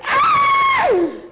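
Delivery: loud, very high shriek; piercing startled yell; terrified scream. Acted. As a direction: scream out in acted fright. Boy screams at the discovery.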